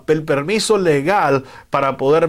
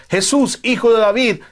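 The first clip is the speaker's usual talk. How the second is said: higher-pitched plea, only mildly raised in volume; half-raised imitation of a beggar's cry; calling out; 7 semitones higher than usual. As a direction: call out to Jesus in a lifted pitch. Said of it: "tenemos que dar voces diciendo".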